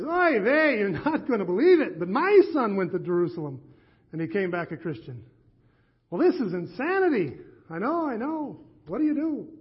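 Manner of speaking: an exasperated Yiddish lilt; Yiddish accent. Acted. Rabbi admits his own son converted, in a Yiddish accent. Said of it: "they begged me not to do the accent".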